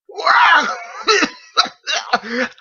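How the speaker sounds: high, loud hacking cough; violent cough. A narrator's reading. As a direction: cough it out hard. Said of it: "a violent cough blasted out of her".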